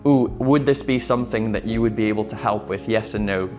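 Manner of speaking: ordinary talk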